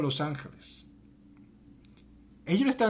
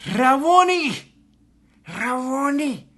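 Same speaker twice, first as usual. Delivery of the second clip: high, loud overjoyed cry; joyful cry; 9 semitones higher, 6 dB louder, slower than usual. Acted. Mary recognizes Jesus and cries his name, overjoyed. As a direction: call out his name in wonder.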